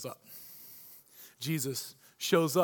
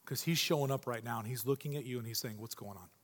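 hushed; 8 semitones lower, 9 dB quieter than usual. Real, not acted. Intimate address to the listener.

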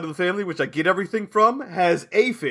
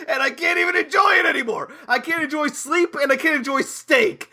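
exasperated whine; 8 semitones higher than usual. Real, not acted. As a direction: wail it out in frustration. Exasperated complaint, shout measured.

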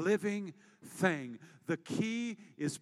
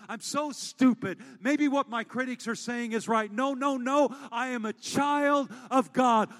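higher, louder, driving the point home; full-force preaching; 5 semitones higher, 8 dB louder than usual. Acted. Pastor declaring identity in Christ to the congregation, full force. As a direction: preach it with fire to the back row.

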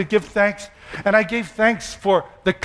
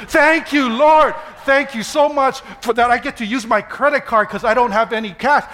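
exuberant thanks prayer; 5 semitones higher than usual. Pretend. Loud mock prayer, wife laughing.

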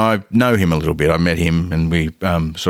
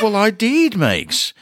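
acted protest; 12 semitones higher, slower than usual. Acted protest to the model's teasing.

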